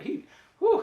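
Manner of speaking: high, loud whoop; excited woo. Real, not acted. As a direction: swoon out loud. Swooning over character.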